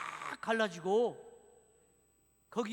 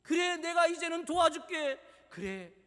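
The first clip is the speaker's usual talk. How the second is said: high, emphatic acted assurance; God's promise; 7 semitones higher, 4 dB louder than usual. God promising to help the church, emphatic assurance. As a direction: declare help with rising conviction.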